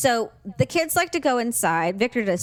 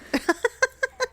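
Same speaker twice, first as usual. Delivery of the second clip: high laugh; 14 semitones higher than usual. Real, not acted. Laugh after retold comeback.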